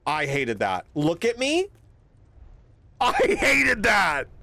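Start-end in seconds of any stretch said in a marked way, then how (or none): 0.9-1.7 imitating the yell. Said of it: "Someone just yelled at us". 3.0-4.3 higher and slow, stretched into a hammed-up yell; drawn-out mock shout. Streamer echoing the creepy voice with a slow mock shout.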